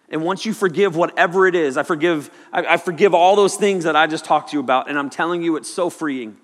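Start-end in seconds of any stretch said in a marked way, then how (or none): none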